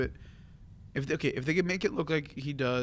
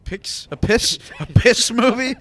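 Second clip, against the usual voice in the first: much higher and louder, mock-shocked repeat; incredulous outburst; 10 semitones higher, 12 dB louder, slower than usual. Real, not acted. Teasing a friend who said piss instead of Pixar, loud incredulous yelp. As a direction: pounce on the slip with loud disbelief.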